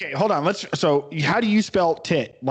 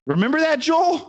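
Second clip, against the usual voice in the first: mock outrage; 10 semitones higher than usual. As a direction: squeak his name in fake offence. Mock indignant aside to Joel.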